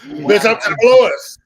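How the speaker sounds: high, loud imperious diva; haughty star scoffing at a lowball offer; Jennifer Lewis diva voice. Retold or imitated. Guest imitating the star scoffing at the fee. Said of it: "That's what she told me".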